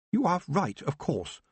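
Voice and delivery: put-on high, prim young lady conceding; a noblewoman giving way gracefully; young Baroness. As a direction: agree with noble poise. Baroness agrees.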